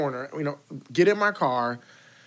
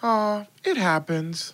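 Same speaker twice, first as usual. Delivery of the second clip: tender consoling; 5 semitones higher, much slower than usual. Retold or imitated. Mom to son after his confession, tender consoling. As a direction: comfort him like a mother, drawn out.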